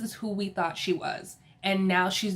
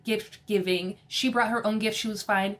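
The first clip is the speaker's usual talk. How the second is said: ordinary talk, nothing marked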